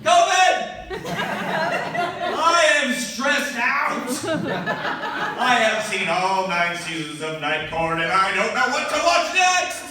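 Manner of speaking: high, loud hammed-up desperation; mock stressed plea. To liquor store clerk, high loud hammed-up plea. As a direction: beg like it's an emergency. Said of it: "And I'm like".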